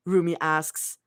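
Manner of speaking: ordinary delivery, nothing marked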